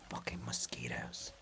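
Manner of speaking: whispered aside; muttered whispered swear; whispered curse. Acted. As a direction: hiss it under your breath. Aside during scene.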